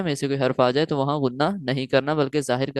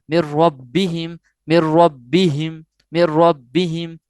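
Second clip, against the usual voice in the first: مر ربہم very slow, louder, the ب bounced; with force and zeal; 4 dB louder, much slower than usual. Shown as a demonstration. Teacher showing students: مر ربہم slow and forceful. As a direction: hit مر ربہم slowly and forcefully. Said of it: "یہاں زور دے کر جوش سے پڑھیں گے".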